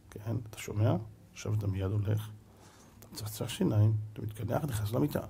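low, soft, controlled orders; cold authoritative voice. Shown as a demonstration. Parent sending a child to bed, cold quiet authority. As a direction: give quiet, chilly commands without raising your voice. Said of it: "שמדבר בקול קר".

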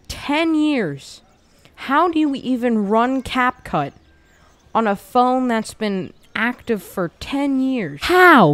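loud high disbelief; incredulous. Real, not acted. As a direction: exclaim in disbelief. Disbelief at old phone.

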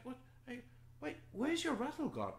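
put-on high-pitched baby-talk voice; fussing mother. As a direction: coo high and gently to a crying infant. Mother fussing over crying baby.

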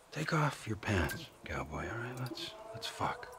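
menacing low taunt. Acted.

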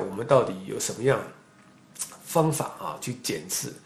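normal talking voice

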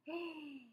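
high sharp gasp; shocked gasp. Real, not acted.

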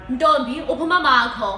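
loud, quick demanding question; insistent father. Acted. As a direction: raise your voice as a father demanding an answer. Father repeats question more forcefully.

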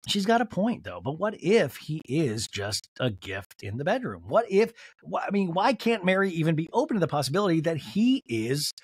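ordinary talk, nothing marked

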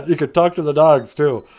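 laughing. Real, not acted. Laughing while telling.

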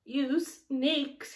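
put-on high, loud sobbing child; a crying boy rounding on his siblings; sobbed insult. A narrator's reading. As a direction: cry the words out hurt. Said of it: "he sobbed".

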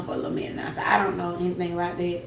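soft unvoiced whisper; whispered aside. Real, not acted. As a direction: drop to a whisper.

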